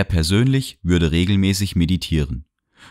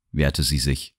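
plain reading voice

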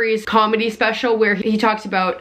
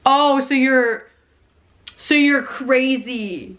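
slow, halting mock realization; dawning realization; slower than usual. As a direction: act out slowly grasping it, with long pauses. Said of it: "realize they're talking to a frickin' nutball".

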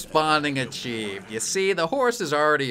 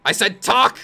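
angry demand; 6 semitones higher, 6 dB louder than usual. Pretend. Roleplaying a rough interrogation.

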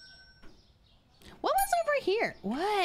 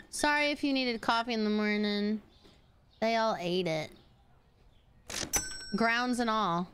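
ordinary talk

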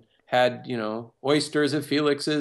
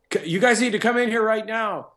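higher, louder, urgent call from the booth; excited engineer; 9 semitones higher, 4 dB louder than usual. Retold or imitated. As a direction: call them in, a little wound up. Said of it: "And he goes".

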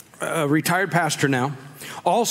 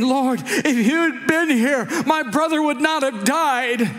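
raised, drawn-out mock-tearful lament; crumbling plea; 10 semitones higher than usual. Mary at Jesus' feet over her dead brother, crumbling plea. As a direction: plead it out, high and distraught. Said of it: "And she crumbled and she fell".

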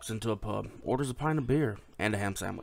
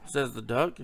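normal talking voice

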